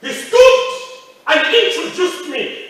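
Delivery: preacher's triumphant revelation; excited shout. Acted. Dramatic reveal to congregation.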